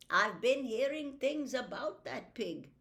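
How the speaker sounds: put-on an old doctor savouring the thought; lightly voiced old doctor.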